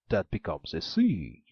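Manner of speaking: loud, boastful ogre voice; the ogre boasts of his magic; an ogre explains his magic pin to the maiden, loud. A narrator's reading.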